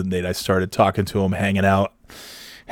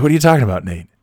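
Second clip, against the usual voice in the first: playing dumb; 3 semitones higher, 3 dB louder, much faster than usual. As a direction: feign innocence, pitched up. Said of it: "I'm like".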